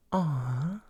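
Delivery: thin, airy "aw"; light vocal weight, soft; soft, breathy aw. Shown as a demonstration. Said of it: "nice sort of breathy, soft sound".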